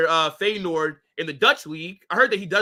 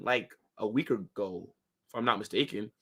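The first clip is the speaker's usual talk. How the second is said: ordinary delivery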